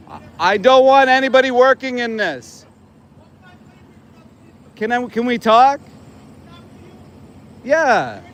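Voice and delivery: earnest, high-pitched protest in a dispute. Real, not acted. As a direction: argue that you are on their side. Pleading his case to angry worker.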